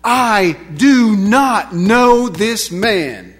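loud, slow, every word hammered; forceful word-by-word disavowal; emphatic denial. Acted. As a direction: swear it with total conviction. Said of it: "emphatically, with conviction, with resolution".

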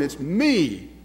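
ordinary talk

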